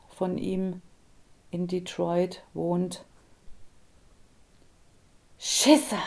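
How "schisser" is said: sharp jeer from a distance; a distant voice spits out the insult; taunting call. A narrator's reading. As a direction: call him a coward, mocking. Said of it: "die mich erneut Schisser nannte".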